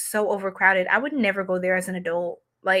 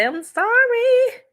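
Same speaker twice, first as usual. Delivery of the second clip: embarrassed yelp; 14 semitones higher, 5 dB louder, slower than usual. Real, not acted. Apologizing after nearly flashing.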